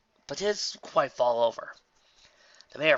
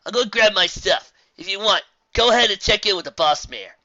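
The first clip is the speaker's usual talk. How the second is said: higher, loud character voice; calling out; 9 semitones higher, 12 dB louder than usual. Acted. Traveller sending companions off to check in, calling out loudly. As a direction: call it over to your companions.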